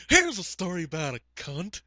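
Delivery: Harry Carey. Acted. Agent introducing a story, put-on old announcer voice. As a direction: announce it in a strained mock-broadcast voice. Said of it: "slipped into Harry Carey".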